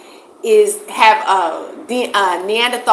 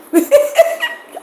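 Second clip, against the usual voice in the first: a sudden high burst of laughter, louder; 3 dB louder than usual. Real, not acted. Streamer cracks up at her own Nephilim joke, high laugh. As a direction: cackle at your own joke.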